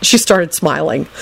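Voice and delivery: ordinary delivery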